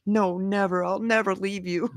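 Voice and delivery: put-on high, small childlike promise; imitated pleading little boy. Son promises mom he will stay, mock pitiful. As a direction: swear devotion in a tiny kid voice. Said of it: "He's like".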